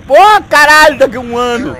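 high loud furious yell; outraged shout. Real, not acted. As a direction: explode at the absurd wait. Rescue takes a year. (grito indignado)

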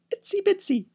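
squeaks up very high on itsy bitsy; tiny squeaky voice. Acted. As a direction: make the little arm sound tiny. Mocking the crab's tiny arm.

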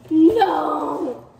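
loud, dismayed "no"; dismayed cry. Real, not acted. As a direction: cry out in disbelief. Finds no tuner in box.

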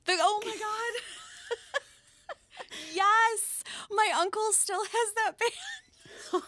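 high, loud, laughing disbelief; squealing with delighted recognition; real delighted surprise. Real, not acted. Delighted surprise at family band name.

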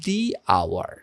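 "the hour", "the" said as thee, slowly. Shown as a demonstration. Teacher showing students the correct "the hour", slowly. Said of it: "hour বলতে পারবো না, আমরা বলবো".

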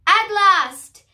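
high, loud parrot cry; croaking parrot. A narrator's reading. Bored ship's parrot finally getting action, croaking loudly. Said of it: "croaked Jim Squawkins".

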